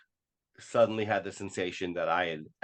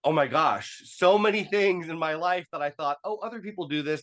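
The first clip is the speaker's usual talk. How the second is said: high-pitched, re-enacted revelation; amazed relief, acted out; relieved realization; 8 semitones higher than usual. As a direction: let the discovery lift your pitch high. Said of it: "was relieved because it was like".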